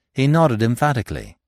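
usual speaking voice

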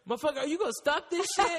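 high, fast laughing dog protest; a dog yelping at his owner through laughter. Retold or imitated. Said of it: "Pimp was looking at me like".

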